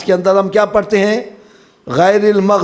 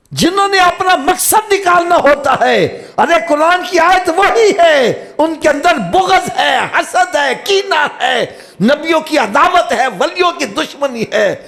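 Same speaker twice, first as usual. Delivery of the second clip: fiery preaching; 11 semitones higher than usual. Real, not acted. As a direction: thunder at the congregation. Preacher rises to full force. (جوشیلی خطابت، بلند آواز میں)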